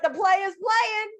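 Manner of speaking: high, loud hype shout ending in a whoop; playful pretend hype with a big woo; mock hype cheer. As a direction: hype it up like a party caption, then whoop. Imagined hype caption, cheering.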